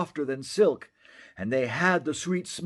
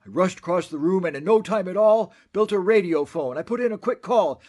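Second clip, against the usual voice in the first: fast, louder, rushing; hurried narration; 5 semitones higher, 5 dB louder, faster than usual. A narrator's reading. Onceler telling how he hurried to call family, fast. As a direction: rush through it like frantic work.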